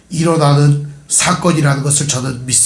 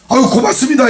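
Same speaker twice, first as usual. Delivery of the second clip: fawning thanks; 8 semitones higher than usual. Retold or imitated. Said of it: "이러지 않아요".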